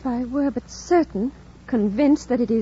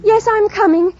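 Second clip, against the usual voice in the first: higher, louder urgent calls; calling out; 10 semitones higher, 6 dB louder than usual. Acted. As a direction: shout across a great distance. Jane calls back to the voice.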